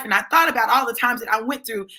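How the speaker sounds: normal talking voice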